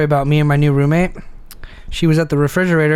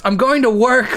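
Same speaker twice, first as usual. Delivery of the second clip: mild mock yell, pitched up; retold shout; 7 semitones higher than usual. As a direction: imitate a roommate calling from another room. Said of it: "and she shouts".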